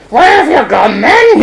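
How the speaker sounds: high put-on puppet voice; squawked puppet question; searching Punch. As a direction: call out high as you hunt about. Punch hunting for his conscience.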